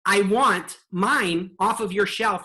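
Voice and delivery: slow, deliberate demand; a touch of mock triumph, slow; triumphant demand. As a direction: spell it out like you've won. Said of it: "and I went".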